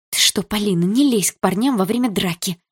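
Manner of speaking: usual speaking voice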